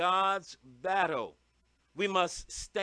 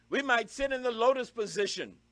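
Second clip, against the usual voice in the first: ordinary talk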